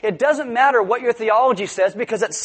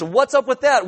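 normal talking voice

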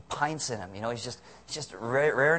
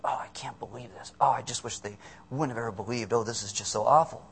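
low, hushed grumble to oneself; private brooding; 5 semitones lower, 4 dB quieter than usual. Retold or imitated. Preacher voices our solitary thoughts about others, brooding. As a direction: start under the breath, lament softly. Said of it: "sometimes we sit in the solitude of ourselves and we just think".

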